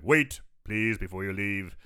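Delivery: low impression swelling to a loud, drawn-out finish; Christopher Lee. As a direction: ham up the horror-film elder, stretching the last words. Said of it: "you're doing a good Christopher Lee".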